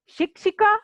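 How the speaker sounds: पाठः called out high with the final breath of the visarga; Sanskrit recitation. Shown as a demonstration. Teacher models Sanskrit word for pupils, called out high. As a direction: model पाठः high and clear for the class to echo. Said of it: "जैसे जैसे उच्चारण किया है".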